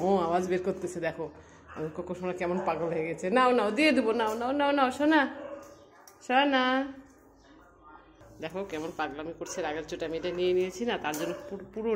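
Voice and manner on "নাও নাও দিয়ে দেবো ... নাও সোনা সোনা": high, loud sing-song offer; coaxing pet talk. Real, not acted. Owner offering Koko the toy, high loud sing-song pet voice.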